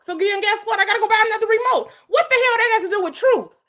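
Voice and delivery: high-pitched, fast, exasperated outburst; heated arguing. Real, not acted. Host disputing a caller's point about truth, heated and argumentative. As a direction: argue it insistently, voice climbing. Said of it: "Why you being argumentative".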